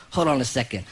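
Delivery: low, deadpan quote; low-pitched casual announcement. Retold or imitated. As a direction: keep it low and matter-of-fact. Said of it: "He goes".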